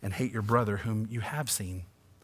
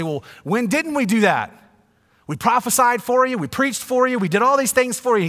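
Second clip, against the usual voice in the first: much higher and louder indignant protest; upset goats; 11 semitones higher, 8 dB louder than usual. Acted. Goats protesting to Jesus they did everything, loud and indignant. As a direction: whine and protest how unfair it is. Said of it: "the goats kinda get upset".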